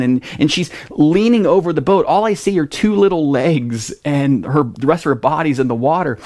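ordinary talk

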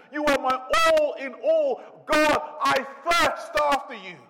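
high, loud, soaring sermon voice; impassioned preaching. Acted. Preacher crying out Jesus' thirst, loud and impassioned. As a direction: cry it out from the pulpit.

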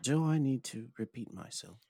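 posh British accent. Shown as a demonstration. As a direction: keep up the posh British act. Continues posh British demonstration.

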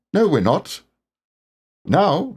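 high storybook animal voice; Rabbit. A narrator's reading. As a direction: correct yourself quickly, pitched well up.

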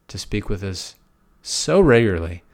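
ordinary talk, nothing marked

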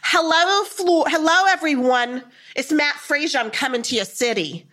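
loud, high showman's greeting; imitating a psychic's big announcement; Matt Frazier. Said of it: "And he's like".

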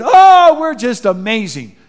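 high, loud fake-bright "Oh"; fake cheerful reply. Preacher mocking a fake-happy couple, overly bright and loud. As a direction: gush like everything's perfect.

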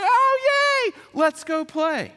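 put-on extremely high, loud squealing child voice; delighted little kid. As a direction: shriek with joy like a toddler. Younger sister delighted.

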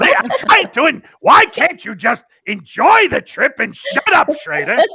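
high, loud, grating comic shout, slow at first; Gilbert Gottfried impression. The universe's guide loses patience with the listener, in a high comic shout. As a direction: yell it like an irritated cartoonish comedian. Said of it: "he starts to turn into Gilbert Godfrey".

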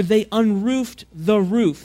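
unroofed the roof said slowly and with weight; stressed phrase. Shown as a demonstration. Preacher stressing unroofed the roof to the congregation. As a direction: lean hard on unroofed the roof. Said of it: "the Greek literally says here".

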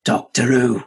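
imitated gruff spirit voice; Bill, gruff voice. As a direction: put on a gruff ghostly voice for the joke. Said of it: "in this gruff voice, she says".

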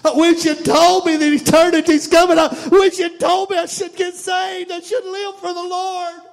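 rising from pleading to a full anguished cry; anguished son building to a shout. Acted. Son in hell crying out to his father, building to a shout. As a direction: let the son's regret swell until he's shouting.

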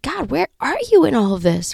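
frustrated plea. Retold or imitated. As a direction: plead upward in frustration. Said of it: "just really get frustrated and be like".

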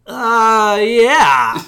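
high, loud, strained fake agreement; awkward unconvincing yes. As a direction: drag out a hammed-up, hesitant yeah. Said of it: "is said in the pregnant pause".

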